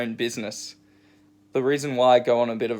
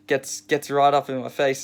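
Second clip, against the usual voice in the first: ordinary talk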